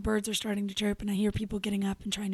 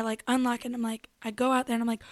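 ordinary talk, nothing marked

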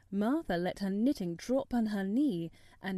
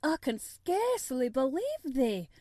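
protesting; 4 semitones higher, 5 dB louder than usual. A narrator's reading. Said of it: "she protested".

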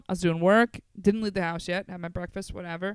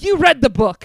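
louder, accusing call-out; mock accusing shout; 4 semitones higher, 7 dB louder than usual. Comic shouting at a lookalike audience member, accusing shout. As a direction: shout it like you caught her.

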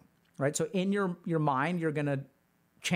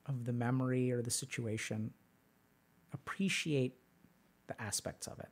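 guided meditation; 5 semitones lower, 6 dB quieter than usual. Acted. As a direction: lead them gently through memory. Leads a meditation.

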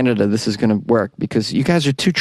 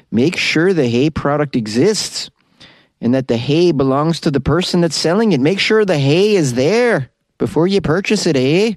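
hammed-up Canadian lilt, climbing as it goes; Canadian accent; 2 semitones higher than usual. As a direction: read the advice as a cartoon Canadian. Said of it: "this Canadian accent".

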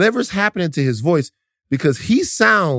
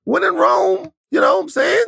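hyped shout; 13 semitones higher than usual. Acted. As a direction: shout it like a hype man. Hyped comic declaration.

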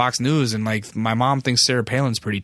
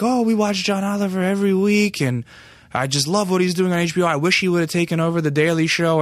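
higher-pitched imitation of an old lady; East Coast old lady; 7 semitones higher than usual. Old lady praising John Oliver, high gushing imitation. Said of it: "cute little East Coast accents".

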